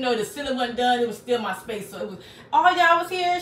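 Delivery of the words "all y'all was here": excited roll call. Real, not acted. Calling out viewers' names.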